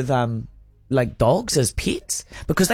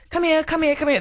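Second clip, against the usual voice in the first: high calling voice, pitched way up; calling a dog; 12 semitones higher than usual. Retold or imitated. Calling a dog in Germany, raised high. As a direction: call the dog over to you. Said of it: "I was like".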